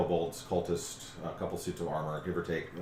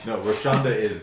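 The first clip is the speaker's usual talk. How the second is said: loud dragon correction; 6 semitones higher, 11 dB louder than usual. Acted.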